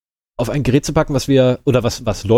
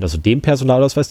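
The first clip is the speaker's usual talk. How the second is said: ordinary delivery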